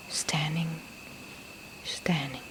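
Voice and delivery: softly murmured, falling away; soft mental note. Shown as a demonstration. Meditation teacher modelling a mental note, soft and inward.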